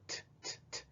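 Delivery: whispered "t, t, t" with breath only; just breathe out the T sound; unvoiced T taps. Shown as a demonstration. Teacher contrasting T with D. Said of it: "Si fuera con T".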